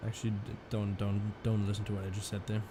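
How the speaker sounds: ordinary delivery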